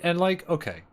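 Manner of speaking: ordinary talk, nothing marked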